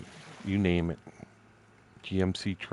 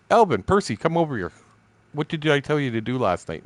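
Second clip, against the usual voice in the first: calling out; 6 semitones higher than usual. Retold or imitated. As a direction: call them over again. Retells calling coworkers.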